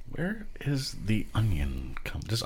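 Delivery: slow, breathy whisper. Acted. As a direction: whisper it like a secret. Reading chip ingredients, mock suspicious.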